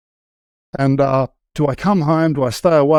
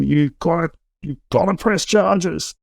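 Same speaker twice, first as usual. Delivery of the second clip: pushy police officer; 5 semitones higher than usual. Retold or imitated. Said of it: "This overzealous police officer was hassling Kim".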